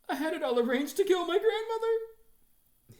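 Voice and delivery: put-on high, slow, loud child's confession; earnest child. A narrator's reading. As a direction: blurt the big confession.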